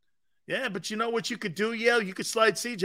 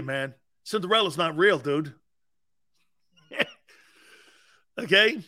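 ordinary talk, nothing marked

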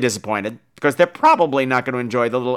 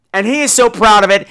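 ordinary talk, nothing marked

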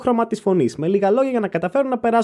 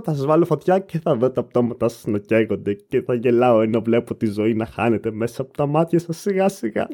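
mock sinister villain; 10 semitones lower than usual. Threatening the weird messagers as a joke, mock sinister.

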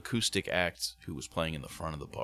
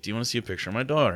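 higher, rapid quote of a woman's question; brisk imitated question, pitched up; quick lighter retelling; 5 semitones higher, 4 dB louder, much faster than usual. Retold again without the deep voice.

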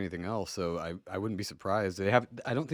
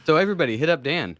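high, loud jokey shout-out; loud announcement; 7 semitones higher, 8 dB louder than usual. Acted. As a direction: call it out to the crowd. Joking call to audience.

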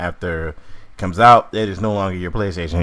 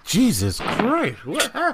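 surprised; 14 semitones higher, 5 dB quieter, slower than usual. Real, not acted. Reacting to a friend's odd audio.

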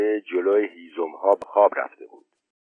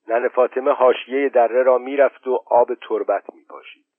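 ordinary delivery

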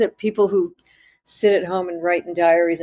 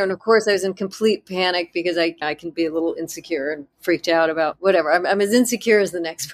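ordinary delivery

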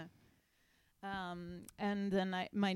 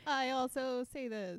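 mock-awkward chime-in, slow and higher; awkward joining in; 5 semitones higher, 4 dB louder, slower than usual. Imitating Gina joining the group chant late, awkwardly drawn out. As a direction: imitate someone clumsily tacking on their line, stretched out. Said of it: "sort of awkwardly joining in".

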